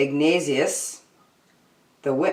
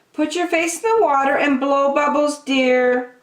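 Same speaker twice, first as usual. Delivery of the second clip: much higher, sweet mother's voice; sugary high mom voice, a bit louder; coaxing mom; 11 semitones higher, 4 dB louder than usual. A narrator's reading. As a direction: coax the little one like a gentle mom. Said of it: "his mom said".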